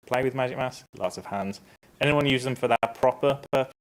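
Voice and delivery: ordinary delivery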